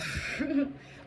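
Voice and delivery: brief high chuckle; short laugh. Real, not acted. Reader amused after warning comfort is over, short high laugh. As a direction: laugh at the blunt news.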